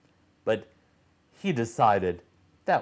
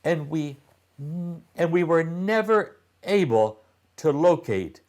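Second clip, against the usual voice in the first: higher voice, calm and matter-of-fact; Remy explaining; 6 semitones higher than usual. A narrator's reading. Remy telling the children about the ship, higher and calm. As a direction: explain it simply to the kids. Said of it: "said Remy".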